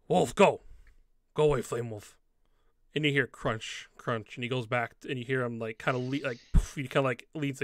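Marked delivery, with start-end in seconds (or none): 0.1-2.2 lightly voiced orc, loud at first; orc shooing wolves. Game master as orc to the wolf behind the boulder, shooing. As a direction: shoo it off in halting orc speech.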